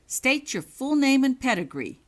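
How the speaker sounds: high, loud order; commanding. Acted. Prefect interrogating Holly, loud harsh command. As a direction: bark out the demand. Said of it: "Richards commanded".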